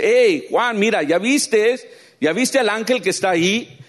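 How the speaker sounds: high, loud call to John; voz del ángel llamando. Retold or imitated. Ángel llama a Juan en la visión. (llamado fuerte del ángel a Juan)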